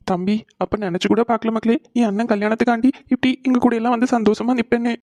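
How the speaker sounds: choked-up father, pitch climbing; tearful. Acted. Father to the son who finally came, crying. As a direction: speak through happy tears. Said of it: "எதுக்குப்பா இப்ப அழுதுட்டு இருக்கீங்க".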